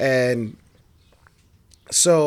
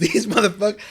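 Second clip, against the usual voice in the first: ordinary delivery, nothing marked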